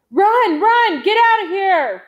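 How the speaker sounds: full-voiced, high, loud roar. A narrator's reading. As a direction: roar a desperate warning to flee. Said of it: "Big John roared".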